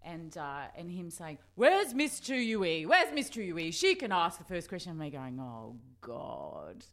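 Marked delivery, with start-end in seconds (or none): 1.5-4.9 higher, louder imitation of a politician singling someone out; put-on public figure's booming summons; Gough Whitlam. Politician calling on the new journalist, imitated summons. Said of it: "In that Gough Whitlam voice". 5.4-6.8 soft, lower mock dread; dying inside. New kid picked for first question, quietly mortified. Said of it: "like dying".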